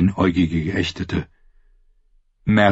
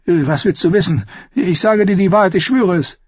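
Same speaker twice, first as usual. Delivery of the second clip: anxious captive; 11 semitones higher, 3 dB louder than usual. Acted. Captive pleads to save his life.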